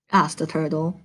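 plain reading voice

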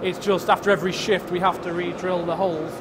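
normal talking voice

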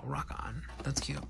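unvoiced little whisper. Real, not acted. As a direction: breathe it out under the breath. Reading journal cover softly.

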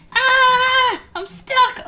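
high shout, stuck; panicked shout. Acted. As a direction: yell out in panic.

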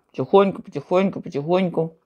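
speaking between bites; talking while chewing. Real, not acted. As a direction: mouth full of rice. Seen 1: Forkful of rice enters mouth at 0.7, then she chews while talking.

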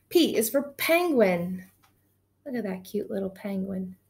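ordinary talk, nothing marked

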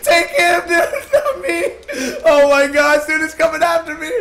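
high, loud frantic yelling through laughs; shrieking half laughing at the chasing shark; laughing panic. Real, not acted. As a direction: scream for the shark to take someone else. Shark attacking, begging it.

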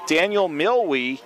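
ordinary talk, nothing marked

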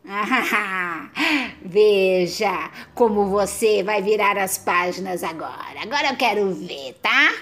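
ordinary delivery, nothing marked

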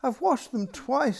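put-on high, loud child's protest; indignant little princess. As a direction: whine like a fed-up little girl. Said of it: "said the little princess".

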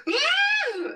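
high sudden shriek; imitating a jumpy startled shriek; startled scream. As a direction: yelp like a spider's on you. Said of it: "He's like".

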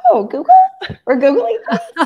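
high, loud, laughing incredulous quip; laughing mock disbelief. At the oncologist's Google printout, laughing disbelief. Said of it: "I'm kind of like".

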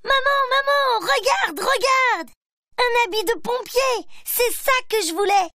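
put-on thrilled child calling mother to look; excited calling. A narrator's reading. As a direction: call out to show mum. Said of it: "Il crie".

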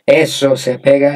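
ordinary talk

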